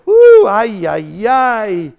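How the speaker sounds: loud high whoop into drawn-out "ay, ay, ay"; overwhelmed exclamation. Acted. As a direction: exclaim as if bowled over. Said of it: "You go".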